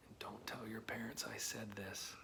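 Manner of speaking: put-on soft secret whisper to the kids; conspiratorial whisper. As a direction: whisper it so the parents can't hear. Secret not for parents.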